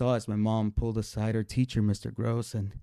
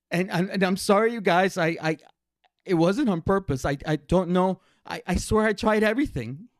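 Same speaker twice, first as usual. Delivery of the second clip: high, loud, stammering plea; acted panicky kid begging forgiveness; distraught apology; 8 semitones higher, 5 dB louder than usual. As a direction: plead with the class, desperate and tripping over words. Tearful confession to classmates.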